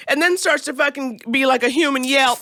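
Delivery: ordinary talk, nothing marked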